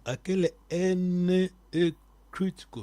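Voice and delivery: normal talking voice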